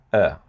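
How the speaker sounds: uh said as a short relaxed neutral schwa; a lax mid-central uh, unstressed; schwa sound. Shown as a demonstration. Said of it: "it sounds like this".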